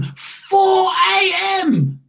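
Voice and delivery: slow, high shout of disbelief; incredulous exclamation. Retold or imitated. Preacher reliving seeing the clock in the gloom, incredulous cry. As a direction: cry it out high, loud and drawn out. Exclaims the early waking hour.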